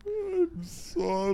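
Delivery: very high, slow falsetto apology; squeaky apology. Pretend. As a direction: squeak out a silly sorry.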